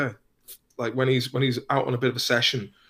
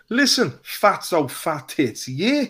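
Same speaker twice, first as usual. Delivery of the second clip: slowed Dublin taunt rising on yeah; mock Dublin jeer, drawn-out; McGregor impression; 5 semitones higher, 4 dB louder, slower than usual. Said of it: "when he said to the Throne guy".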